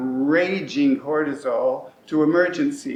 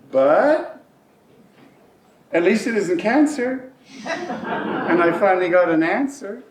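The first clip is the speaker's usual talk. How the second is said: bright burst of relief; relieved; 4 semitones higher than usual. Real, not acted. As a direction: lift up, grateful it's not worse. Relief after bad news.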